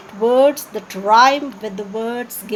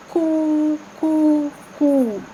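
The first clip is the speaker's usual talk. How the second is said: dove cooing imitation; 4 semitones higher, much slower than usual. Shown as a demonstration. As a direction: coo like a dove. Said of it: "Another one".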